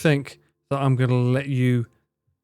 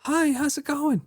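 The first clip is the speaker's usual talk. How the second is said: imitating Connor; 12 semitones higher, 4 dB quieter than usual. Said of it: "before he was like".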